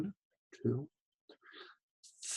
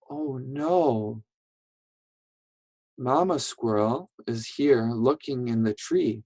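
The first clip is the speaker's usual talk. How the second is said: ordinary talk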